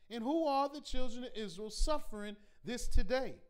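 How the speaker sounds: normal talking voice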